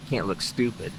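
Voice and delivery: ordinary talk, nothing marked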